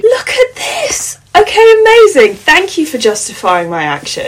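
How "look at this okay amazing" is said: laughing squeal. Real, not acted. Laughing delight at electric blanket.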